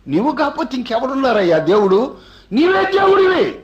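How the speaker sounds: soaring delighted acclaim; joyful exclaim. Retold or imitated. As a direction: cry out in overjoyed worship. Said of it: "అని నేను సంతోషపడి".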